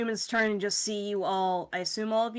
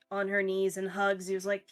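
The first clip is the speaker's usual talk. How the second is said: ordinary talk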